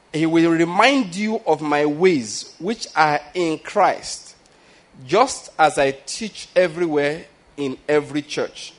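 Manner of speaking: slow, weighty reading with "remind" drawn out; slow scripture reading. Shown as a demonstration. Preacher reading Paul's letter to the church, slow and weighty. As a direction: read Paul's words slowly, letting each phrase land. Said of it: "Please, there's something here".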